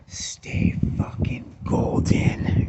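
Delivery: drawn-out whispered catchphrase; slow whisper. Real, not acted. Vlogger telling viewers to stay hydrated, slow whisper. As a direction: whisper it slowly, stretching each word.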